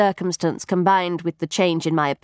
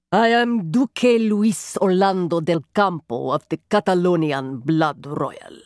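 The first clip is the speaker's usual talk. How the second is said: Spanish duke; 2 semitones lower than usual. A narrator's reading. Duke drawn erect, giving his title in Spanish accent. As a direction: announce yourself proudly in a Spanish accent. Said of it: "a Spanish duke".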